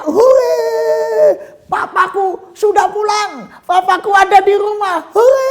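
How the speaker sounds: put-on high, loud child's cheer; child shouting hooray for papa; excited shout. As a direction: cheer like a kid who spots dad is home. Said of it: "Tiba-tiba dia teriak".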